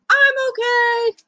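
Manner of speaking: put-on high, bright little-boy reassurance after a tumble; child insisting he's okay; young boy voice. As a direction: chirp it like a small kid who's fine. Child gets up after a fall.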